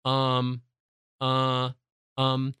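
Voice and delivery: drawn-out monotone filler sounds; demonstrated fillers. Shown as a demonstration. Speaker showing listeners the fillers people use, droning hesitation sounds. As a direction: drone each hesitation on one level note.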